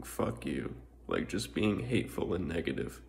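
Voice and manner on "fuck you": ordinary delivery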